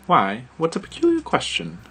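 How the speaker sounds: put-on British accent. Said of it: "she said".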